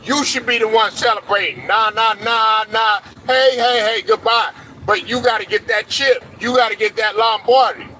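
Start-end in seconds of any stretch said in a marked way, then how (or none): 0.0-4.5 gloating taunt. Pretend. Taunting the rival team after a winning season, singsong and gloating. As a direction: rub it in like a playground taunt. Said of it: "your opportunity to say".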